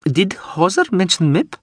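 high, bright-pitched small woman; high woman's voice. A narrator's reading. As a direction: ask it in her high voice. Dorolo voiced high again.

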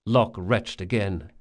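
ordinary delivery

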